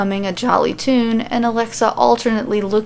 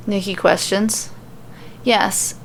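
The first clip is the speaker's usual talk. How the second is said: plain reading voice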